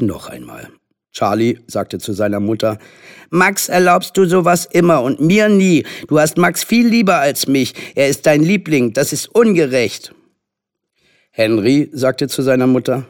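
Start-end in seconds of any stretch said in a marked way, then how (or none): 3.3-10.1 put-on high, aggrieved little boy; whiny complaint. A narrator's reading. Charlie accusing mother of favouritism, aggrieved. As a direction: protest the unfairness to mother.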